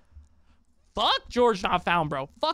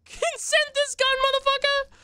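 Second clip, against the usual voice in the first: much higher, slow put-on threatening voice; mock tough guy; slower than usual. Joking at the commenter in a hammed-up high squeaky threat. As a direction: ham up a cartoon gunman.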